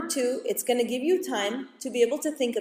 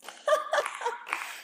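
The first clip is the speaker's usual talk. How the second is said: sly laugh; 6 dB quieter than usual. Acted. Mocking laugh before joke.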